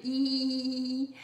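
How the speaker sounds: long, steady "I" as French ee; smiling vowel. Shown as a demonstration. Teacher telling a child it's like laughing, smiling vowel. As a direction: stretch "I" through a wide smile. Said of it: "on fait un beau sourire".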